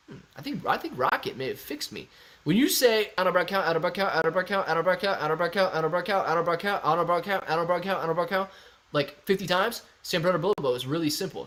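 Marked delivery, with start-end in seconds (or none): 3.2-8.5 run-together repetitions in a steady rhythm; rapid-fire tongue twister. Shown as a demonstration. Streamer testing a tongue twister, rapid-fire. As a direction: rattle it off over and over without pausing.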